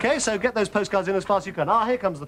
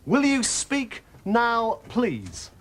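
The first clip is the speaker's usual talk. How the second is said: slow drawn-out request; 2 semitones higher, much slower than usual. Acted. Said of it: "host's drawn-out request to contestant".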